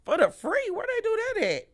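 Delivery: high-pitched disbelieving question; comic, squeaky-high incredulity; incredulous. Retold or imitated. Incredulous repeat of a free offer.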